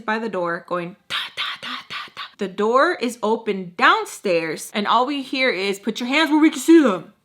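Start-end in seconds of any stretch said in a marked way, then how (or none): 1.1-2.2 unvoiced soft taps; imitating knocking on the door; whispered tapping. 5.8-7.1 louder, higher barked order; police shouting the order through the house; police command. Retold or imitated. Police command heard downstairs.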